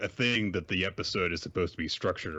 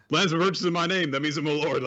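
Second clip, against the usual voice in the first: mocking pompous lord; 10 semitones higher, 6 dB louder, faster than usual. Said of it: "or did you just, like".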